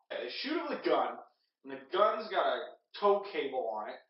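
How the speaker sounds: ordinary talk, nothing marked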